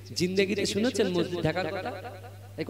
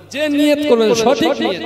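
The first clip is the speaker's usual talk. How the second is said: emphatic preaching; 7 semitones higher, 8 dB louder than usual. Acted. Preacher stresses right intention.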